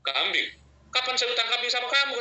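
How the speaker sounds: a startled, rising question; surprised. Retold or imitated. The Prophet, surprised by a goat debt, asks back in shock. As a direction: caught off guard, voice jumps high. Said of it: "Terus Nabi kaget".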